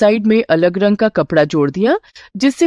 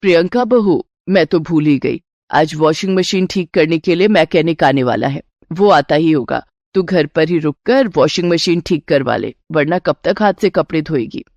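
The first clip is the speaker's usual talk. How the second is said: put-on lower-pitched older woman remembering an errand; excuse-making mother-in-law; 3 semitones lower than usual. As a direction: talk her into staying, voice pitched down.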